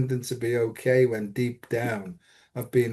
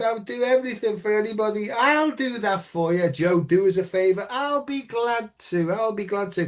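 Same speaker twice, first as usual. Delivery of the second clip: high, over-eager helpful voice; raised, fawning offers of help; eager people-pleaser; 7 semitones higher than usual. Retold or imitated. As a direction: play the overly keen helper. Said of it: "the humility I had".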